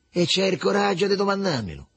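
mildly severe reproach; stern. A narrator's reading. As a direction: scold him for daring to ask. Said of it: "fece severo il Riccetto".

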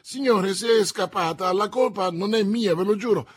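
high, loud frantic self-defence; exclaiming. A narrator's reading. Prisoner disowning blame before the commissioner, exclaiming. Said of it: "esclamò Bonacieux".

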